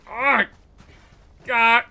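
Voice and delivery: loud, high pained outburst; cramp cries. Real, not acted. Gamer hit by cramp mid-game, loud pained cries. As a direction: cry out loudly as cramp seizes you. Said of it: "I got cramp".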